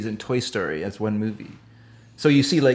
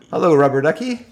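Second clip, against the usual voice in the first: over walkie talkie; 4 semitones higher than usual. Retold or imitated. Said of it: "We got ourselves a convoy".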